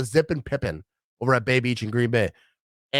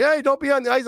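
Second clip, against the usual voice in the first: much higher mock yell to the driver; 10 semitones higher than usual. Friends warning a truck driver on the ice, mock yell. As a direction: call out a warning. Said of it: "yelling at him".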